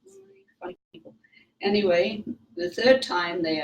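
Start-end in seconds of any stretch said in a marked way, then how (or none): 0.1-1.1 near-silent inner aside, higher and much softer; a hushed private thought; thought to herself. Retold or imitated. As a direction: murmur it under your breath. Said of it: "I thought".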